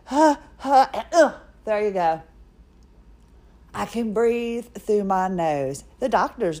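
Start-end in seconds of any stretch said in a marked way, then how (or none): 0.1-0.9 quick, louder pained huffs; sharp panting breaths. Retold or imitated. During painful nose treatment, short loud pained huffs. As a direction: puff through the pain in short bursts.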